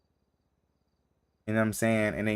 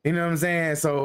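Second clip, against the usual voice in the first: ordinary talk, nothing marked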